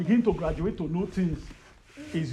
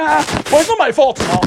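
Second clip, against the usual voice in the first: put-on higher, louder childish protest; pleading innocence; 8 semitones higher, 8 dB louder than usual. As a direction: whine your innocence to Jesus. Defending himself to Jesus.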